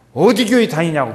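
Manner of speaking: loud, insistent question about church; just ask it out loud and bluntly; emphatic asking. Shown as a demonstration. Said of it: "그냥 그렇게 물어봐요". (크고 거침없이 묻는 말투)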